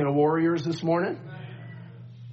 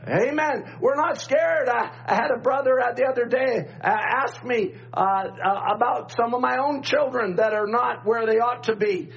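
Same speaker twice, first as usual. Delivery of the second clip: preaching; 6 semitones higher than usual. Acted. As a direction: preach it with rising fire. Rising preaching at full force.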